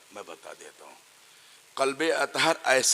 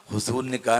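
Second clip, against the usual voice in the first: usual speaking voice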